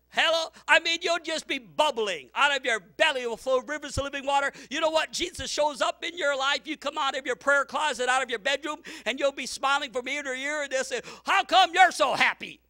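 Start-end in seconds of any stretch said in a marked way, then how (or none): 0.1-10.6 rising, louder revival preaching; a pastor warming up the church; full force preaching. Acted. Preaching builds up. 11.2-12.6 a louder, higher jab at the happy believer; mock resentful neighbour; mock resentful question.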